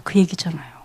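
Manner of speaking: breathy hushed aside to the congregation; whisper. Real, not acted. Preacher whispering an aside to the congregation. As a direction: drop to a whisper mid-sermon.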